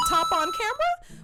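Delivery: high, loud mock exclamation; hammed-up shout. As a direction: blare it out in exaggerated astonishment. Hammed repeat with sound effects.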